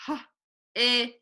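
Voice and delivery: his split into its sounds, H- then is; sounding out his. Shown as a demonstration. Teacher sounding out the word his for her class, sound by sound. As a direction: break his apart as H and is, then blend.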